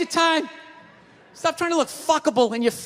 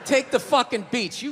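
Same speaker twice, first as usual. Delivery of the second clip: ordinary talk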